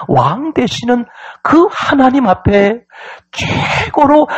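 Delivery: high, drawn-out preacher's cadence; forceful preaching. Real, not acted. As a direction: build phrase on phrase to a ringing peak.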